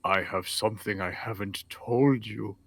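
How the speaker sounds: low hush, then slow weighty line; hammed-up portentous horse; mock dramatic reveal. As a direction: reveal the secret like a grand confession. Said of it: "the horse is like".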